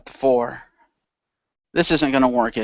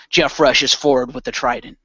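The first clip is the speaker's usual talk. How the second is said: ordinary talk, nothing marked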